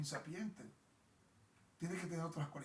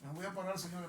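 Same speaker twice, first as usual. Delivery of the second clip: usual speaking voice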